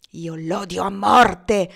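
loud, slow, seething with hatred; hateful exclamation. A narrator's reading. Legree in bed, raging against Tom, slow and loud. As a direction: spit out the hate slowly. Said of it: "esclamò Legree".

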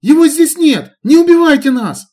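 put-on high, loud panicked girl voice; frightened babbling. A narrator's reading. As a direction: babble in terror, stumbling. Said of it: "залепетала".

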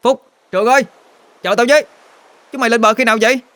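very high, far-carrying call across the river; gân cổ gọi to. Acted. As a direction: shout to friends walking away. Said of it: "Tôi gân cổ lên gọi". (gọi to thất thanh, giọng cao vút)

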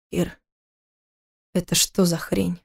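choked with shock, forced out slowly; strangled rasp. Acted. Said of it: "сдавленно прохрипел он". (сдавленный хрип, тише и медленно)

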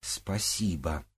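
soft, slow and firm; firm, deliberate thanks. A narrator's reading. Blushing girl repeats her thanks, firmly. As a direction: say it quietly, drawing out each syllable. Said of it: "Краснее Ольга твёрдо повторила".

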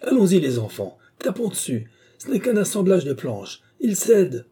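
high, urgent commands; a commander spurring his people on; urgent rallying. Acted. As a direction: drive the group to smash the wall. Commander urging others to break wall.

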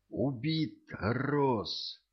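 high, drawn-out cry of alarm; frightened exclamation. A narrator's reading. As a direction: break shocking news in fright, stretching each word. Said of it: "воскликнул он испуганно".

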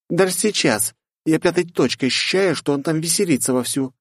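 put-on high, insistent young woman; won't let it go; restless girl voice. Lifa continues. (настойчивая девушка)

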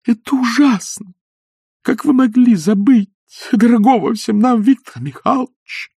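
горячий упрёк. Acted. Елена горячо упрекает гостей.

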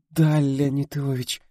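high, slow, faint-hearted assent; breathless babble. A narrator's reading. Actress answers the scolding director, breathless babble. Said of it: "пролепетала актриса, задыхаясь".

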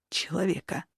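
человека said hushed and shaky; trembling. A narrator's reading. Android pleads with the sorceress, человека trembling and hushed. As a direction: let человека tremble, fading quietly. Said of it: "дрожащим голосом произнёс".

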